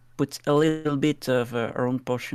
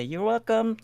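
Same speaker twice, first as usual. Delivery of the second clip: lightly acted, lifted higher pitch; a higher, courteous sing-out; polite reply; 8 semitones higher than usual. Player's character bows to the creatures, politely lifting the voice. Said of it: "bow to them and say".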